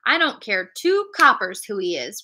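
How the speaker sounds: ordinary delivery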